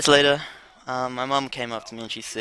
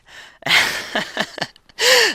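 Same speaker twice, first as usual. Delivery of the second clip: high burst of laughter; cracking up at his own story; loud laugh; 9 dB louder than usual. Real, not acted. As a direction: laugh out at the memory. Laughing at lucky save.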